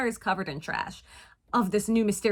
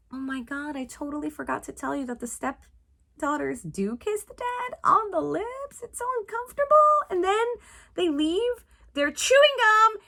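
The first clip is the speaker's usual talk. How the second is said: high, squeaky disgust; grossed out; 7 semitones higher than usual. Real, not acted. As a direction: squirm with disgust. Disgusted retelling.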